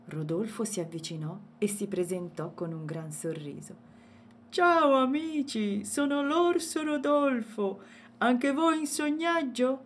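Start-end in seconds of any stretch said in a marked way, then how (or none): 4.5-9.8 high, slow cheerful bear voice; a big bear greeting new friends; friendly bear. A narrator's reading. Bear character voice. (orso allegro, voce alta e lenta)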